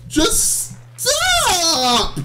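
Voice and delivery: high, stretched-out cry; real frustrated yell; exasperated outburst. Real, not acted. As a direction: wail it at the game in frustration. Losing patience with the game.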